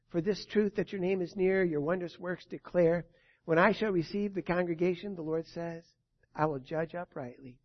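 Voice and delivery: usual speaking voice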